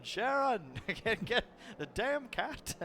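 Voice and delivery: high-pitched mock yell, breaking into laughter. Man chasing a cat yells for [name], imitated flustered yell. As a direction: imitate a flustered rock star calling out, drawn out. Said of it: "he's yelling".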